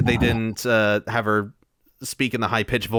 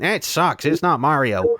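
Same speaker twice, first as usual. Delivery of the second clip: higher-pitched imitation of dismissive critics; mock dismissive; 5 semitones higher than usual. Fans judging the first trailer instantly, mock dismissive. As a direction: put on a complainer's voice, higher than usual.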